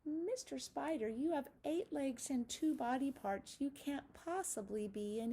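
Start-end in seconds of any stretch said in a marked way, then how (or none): none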